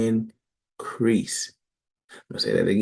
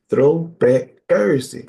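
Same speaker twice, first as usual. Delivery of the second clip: drawn out and louder, Throwback Thursday called slow; slow called-out catchphrase; 4 semitones higher, 6 dB louder, slower than usual. Real, not acted. Host announcing the segment to Israel, slow and loud. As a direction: stretch Throwback Thursday like a show opener.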